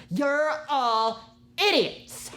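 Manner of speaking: slow, high, exaggerated contempt; mock livid. Imitating the livid cashier addressing the men, mock fury. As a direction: draw out the insult in hammed-up outrage. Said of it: "She was so livid".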